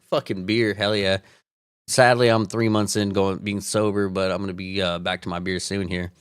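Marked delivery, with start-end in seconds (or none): none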